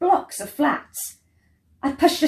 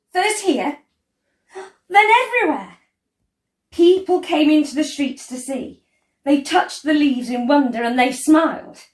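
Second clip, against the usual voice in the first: higher and louder, full of wonder; rising delight; 6 semitones higher, 6 dB louder than usual. A narrator's reading. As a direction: let the joy spill out over the city. Joy spreads through city.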